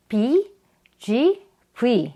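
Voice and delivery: ordinary delivery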